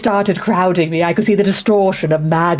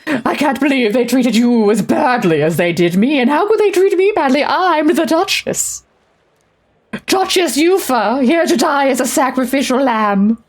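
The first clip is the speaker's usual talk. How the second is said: imperious plummy grande dame; 7 semitones higher than usual. Acted. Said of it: "Duchess voice".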